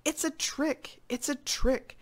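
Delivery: contained storybook yell; yelling in alarm. Acted. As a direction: raise the alarm in a high voice. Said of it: "all yelling".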